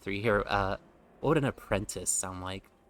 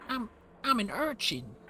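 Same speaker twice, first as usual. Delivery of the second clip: much higher, squeaky street-urchin voice; high-pitched urchin; 13 semitones higher than usual. Acted. Voicing the urchin class, much higher pitched urchin voice. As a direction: pitch way up like a scrappy orphan kid. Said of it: "same accent, but higher pitched".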